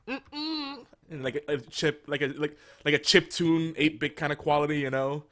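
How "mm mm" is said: sassy droid sound. Retold or imitated. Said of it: "I just go".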